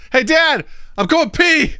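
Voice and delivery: high, loud mock-kid announcement; hammed-up kid voice; child calling Dad.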